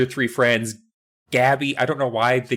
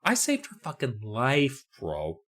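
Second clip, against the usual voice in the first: muttered rant; 9 dB quieter than usual. Retold or imitated. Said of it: "she's like".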